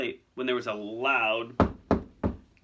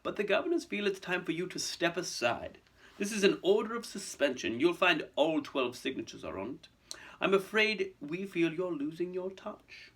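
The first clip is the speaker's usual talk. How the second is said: higher, silky villain voice; smug aristocrat; 6 semitones higher than usual. Acted. Malfoy handing Dumbledore his suspension, smug. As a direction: deliver bad news with false regret.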